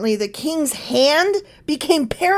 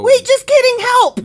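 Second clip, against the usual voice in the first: high, loud panicked backtracking; mock panic; 13 semitones higher, 9 dB louder than usual. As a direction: yelp for help in hammed-up alarm. Mimics the king begging for help.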